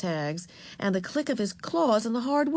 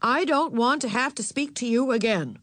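higher, firm final warning; stern; 6 semitones higher, 4 dB louder than usual. A narrator's reading. Mother cutting off Ramona's protest, stern. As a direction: warn firmly, cutting off argument. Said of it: "to be as stern as this".

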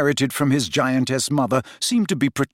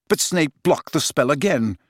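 usual speaking voice

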